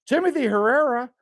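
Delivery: ordinary talk, nothing marked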